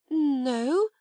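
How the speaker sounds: small high frightened no; faltering no. A narrator's reading. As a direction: falter the word out. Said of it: "Ermengarde faltered".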